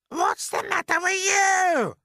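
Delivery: high, loud, slow screech. Acted. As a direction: screech at the boy from above. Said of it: "Aunt Spiker screeched".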